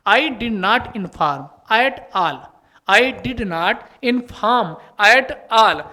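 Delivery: slow, word-by-word reading; slow dictation read. Shown as a demonstration. Teacher reading the question slowly to students. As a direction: spell out each word for the class. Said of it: "teacher reading question slowly".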